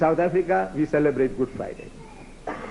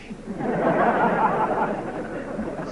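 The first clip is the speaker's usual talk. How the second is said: laughing; 5 semitones higher than usual. Real, not acted. Audience aside, self-amused laugh.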